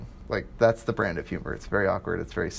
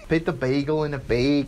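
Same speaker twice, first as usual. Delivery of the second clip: mock Wisconsin vowels on bagel and bag; Wisconsin accent; 3 semitones higher than usual. Joking with friends about vowels, mock Wisconsin accent. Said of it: "Dude, Wisconsin".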